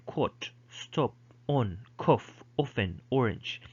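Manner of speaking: short rounded British O; British "stop", "on", "cough" with rounded short o; British pronunciation. Shown as a demonstration. Teacher listing examples of British English, British vowel demonstrated. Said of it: "in British English, they would say".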